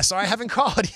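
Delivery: laughing apology. Retold or imitated. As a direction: crack up while repeating the excuse. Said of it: "host repeats guest's line".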